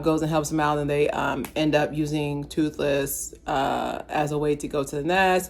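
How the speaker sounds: ordinary delivery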